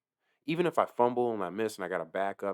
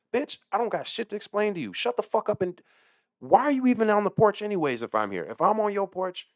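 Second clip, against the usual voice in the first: exasperated rant; 11 semitones higher, 4 dB louder than usual. Real, not acted.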